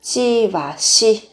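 "chi và shi" said very slowly, each syllable apart; slow contrast of chi and shi. Shown as a demonstration. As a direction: drag out "chi" and "shi" so they can be compared. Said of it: "khác nhau một chút ở cái từ".

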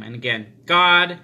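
ordinary delivery

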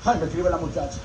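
ordinary talk

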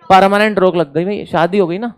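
normal talking voice

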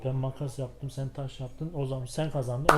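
normal talking voice

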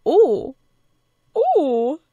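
high, loud teasing oohs; real squeal of interest; excited ooh. Real, not acted. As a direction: ooh loudly at a budding romance. Reacting to flirting in game.

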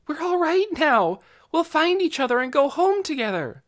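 relieved thought. A narrator's reading. Lena's relieved hopeful thought.

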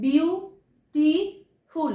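বিউটিফুল very slowly, one syllable at a time; three separate beats, বিউ, টি, ফুল, for বিউটিফুল; broken into syllables. Shown as a demonstration. Teacher counting syllables for students, বিউটিফুল slowly split in three. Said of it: "তিনটে syllable".

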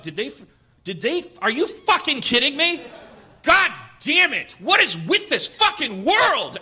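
loud, high, slowing outburst; furious rant. Retold or imitated. Reliving his fury at the missing Snapple, loud and high. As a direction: let it explode, building to rage.

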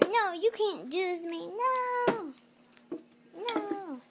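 desperate protest. Acted. Villain dragged to jail.

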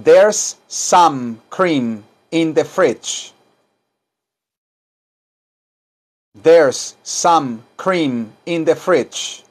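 very slow, each word drawn out; slow model sentence. Shown as a demonstration. Teacher models slowly for class to repeat.

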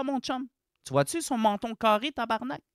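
ordinary talk

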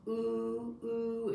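high hooting train sound; train whistle ooh. Shown as a demonstration. Showing a pretend little kid the train sound, high hoot. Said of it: "it's a train".

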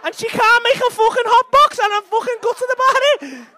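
loud, high, laughing outrage; gutted and yelling about the hot box; outraged laughing rant. Real, not acted. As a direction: shout your disgust through laughter. Fuming rant about the takeaway.

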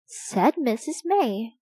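ordinary delivery

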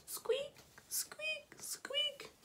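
very high, small squeaks; thin little voice, far up high; squeaking noise. A narrator's reading. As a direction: squeak each word like a mouse. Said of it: "A squeaking noise".

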